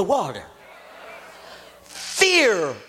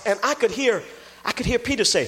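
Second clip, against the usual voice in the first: ordinary talk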